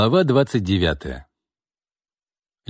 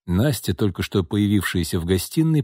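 usual speaking voice

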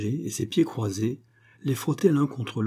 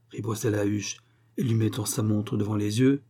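ordinary delivery

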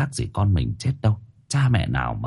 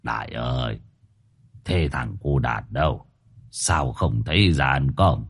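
put-on a light old man's voice, lower, softer and slow; an aging grandfather gently asking for his grandson; 8 semitones lower, 4 dB quieter than usual. A narrator's reading. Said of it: "Ông hỏi bác Đại". (giọng ông cụ nhẹ, trầm và chậm)

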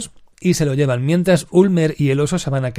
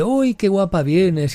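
raised, slow, syrupy flattery; mock-sweet Landa; 7 semitones higher, slower than usual. Landa greeting the actress with exaggerated charm, mock-sweet. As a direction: purr the compliment like a fake charmer.

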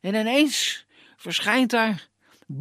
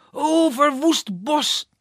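angry prince; 6 semitones higher, 4 dB louder, slower than usual. Acted. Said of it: "zegt hij".

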